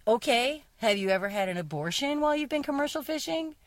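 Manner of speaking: high, pointed mock comeback; pointed retort. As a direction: fire back at the sexist poet. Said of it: "so that we can be like".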